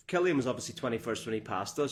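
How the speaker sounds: normal talking voice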